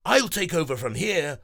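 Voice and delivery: high, loud, fast cheerful voice; smiling hero. Acted. Breezy greeting the friends, smiling. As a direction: greet with a smile. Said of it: "says with a smile".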